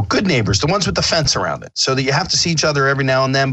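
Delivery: normal talking voice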